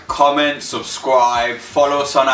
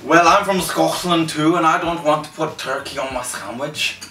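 higher-pitched put-on Scottish accent; 5 semitones higher than usual. Replying to a fellow Scot about turkey, mock Scottish accent. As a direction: answer back as a mock Scot.

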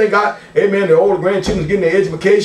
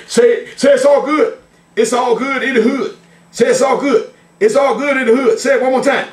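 higher-pitched catchphrase chant; pitched up, rhythmic prompt to the congregation; call-and-response; 5 semitones higher than usual. Real, not acted. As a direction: lead the crowd in repeating it.

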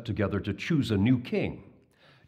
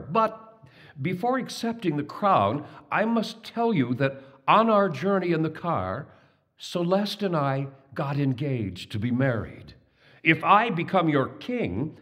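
lightly voiced Babar; 6 semitones higher than usual.

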